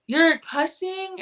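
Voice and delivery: mock shock. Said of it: "gasps, like".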